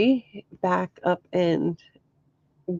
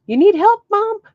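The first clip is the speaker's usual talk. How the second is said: much higher and louder, calling across the creek; son's yell; 13 semitones higher, 7 dB louder than usual. Retold or imitated. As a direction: shout it up the bank to mom. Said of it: "And he yells".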